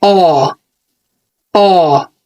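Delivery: a long "آه" with the mouth wide open. Shown as a demonstration. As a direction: stretch "آه" out like a yawn. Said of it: "الصوت ده long".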